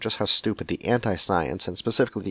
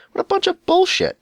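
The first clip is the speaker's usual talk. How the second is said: hammed-up indignant yell, pitched way up; mock outraged shout; 6 dB louder than usual. Reading the crank's complaint about shops, hammed-up outraged yell. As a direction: blow a gasket like a self-righteous crank. Ranting letter writer about mechanics.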